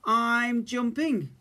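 a shouted warning before the leap; little cub shouting down before jumping; excited cub shout. A narrator's reading. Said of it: "Little Bear shouted".

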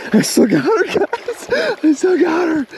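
high-pitched excited fish-fight cry; excited relief. Real, not acted. Angler still fighting the fish tells viewers, excitedly. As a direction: voice leaps up with excitement.

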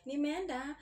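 high, loud mimicked one-upping; self-absorbed friend. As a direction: cut in with your own troubles. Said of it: "They'll start telling you".

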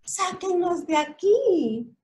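pleading little truck. A narrator's reading. Little blue truck begging the animals to get them out. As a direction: beg to be pulled out. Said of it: "gritó el camioncito Azul".

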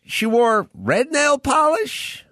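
high, slow, mock-innocent Columbo question; spring the final question slowly and sweetly; Columbo's gotcha question. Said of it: "and he'd go".

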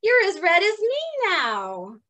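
high, squeaky little bug voice; a delighted tiny ladybug. Acted. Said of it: "said the ladybug".

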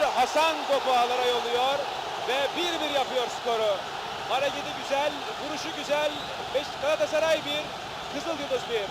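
full-throated goal scream, high and loud; screaming football commentator. Real, not acted. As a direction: scream the goal call at the top of your voice. Goal scored, commentator erupts.

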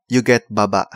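usual speaking voice

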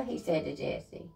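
plain reading voice

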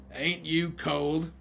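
slow, drawn-out gang leader question; rough gang leader. Acted. Gang leader asks the robed priest about the cold, slow character voice. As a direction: voice a gang leader, drawing out the words.

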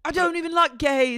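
high loud hammed-up sneer; mock angry James. Co-host voicing James's rant, high loud mock shout. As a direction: spit it out in a squeaky lad voice.

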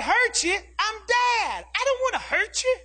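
put-on high, incredulous protest to his child; wounded, high-pitched fatherly objection; indignant dad. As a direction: protest in rising disbelief, pitched well up. Dad protests to his frightened daughter.

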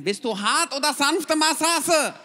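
slow, shifted broken-German masseuse voice; mock accented masseuse asking. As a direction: draw out the question like the little masseuse.

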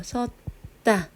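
섰다 broken into 섣, pause, 따; demonstrated syllable split. Shown as a demonstration. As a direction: halt on 섣 before tense 따. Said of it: "발음 어떻게 해요?".

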